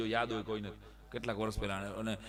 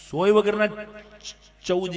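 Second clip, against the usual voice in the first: loud punched punchline; big emphatic reveal; punchline shout; 7 semitones higher, 12 dB louder, slower than usual. Real, not acted. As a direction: hit it hard for the laugh.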